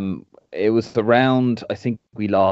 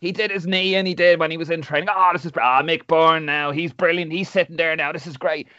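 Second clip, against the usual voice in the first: high-pitched, over-the-top gushing commentator; mocking gushing pundit; 7 semitones higher than usual. Podcaster mocking Niall Quinn's fawning commentary, high and gushing. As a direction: send up a giddy TV pundit cooing over the team. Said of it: "he's just like".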